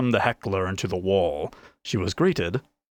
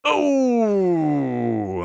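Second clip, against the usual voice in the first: higher, louder sympathetic ooh; pained wince; 9 semitones higher, 4 dB louder than usual. A narrator's reading. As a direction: wince at the sight of the punch. Whole bar winces at punch.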